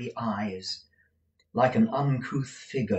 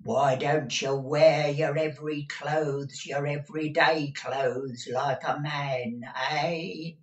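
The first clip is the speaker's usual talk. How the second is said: put-on scolding old housekeeper; 6 semitones higher, 3 dB louder than usual. A narrator's reading. Deaf Peg nagging master about clothes, scolding. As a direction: needle him like an old servant.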